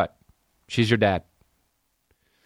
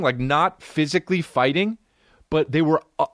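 ordinary talk

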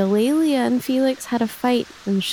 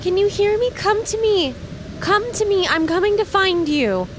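put-on high, raised call to a lost child; calling out as Thea; 12 semitones higher than usual. Thea calling to the crying child in the storm. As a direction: reach the child through the storm.

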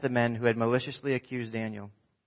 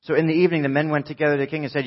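ordinary delivery

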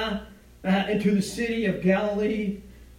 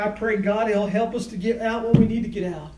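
usual speaking voice

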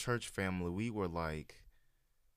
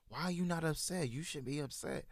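higher, disbelieving retold question; mock incredulous friends; 9 semitones higher than usual. Friends demanding why she is not upset, higher disbelieving mimic. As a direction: imitate friends pushing her to be upset. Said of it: "Everyone was like".

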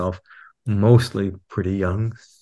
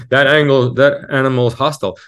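mock labeling; 5 semitones higher, 7 dB louder than usual. Mocking how others label feelings.

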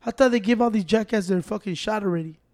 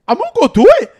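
mock eager yes; 10 semitones higher, 9 dB louder, faster than usual. Eager friend quoted mid-story.

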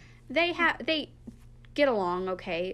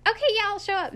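high, louder, over-eager fake yes; mock fake agreement; 12 semitones higher, 6 dB louder, faster than usual. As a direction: pretend to cave just to end it. Caving to pushy recruiter.

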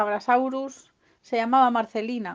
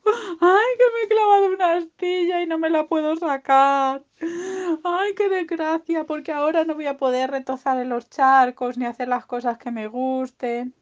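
high, loud wailing lament; hammed-up tearful complaint; mock crying piglet; 6 semitones higher, 5 dB louder than usual. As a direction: whine it out like a hurt little creature. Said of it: "Le vio a su amigo llorando y llorando".

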